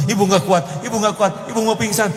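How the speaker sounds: high, fast melodramatic moan; mock fainting mother. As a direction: ham up a mother about to faint. Mocking dramatic mother fainting.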